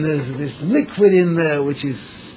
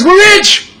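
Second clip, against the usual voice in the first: very high, loud ecstatic cry; overjoyed; 14 semitones higher, 7 dB louder than usual. Acted. Wife discovering diamonds in the pocket, ecstatic high cry. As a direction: shriek it with delight.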